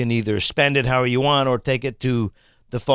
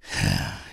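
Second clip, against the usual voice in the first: low, soft sigh; weary sigh; 7 dB quieter than usual. Real, not acted. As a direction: let out a deflated breath. Deflates after the rant.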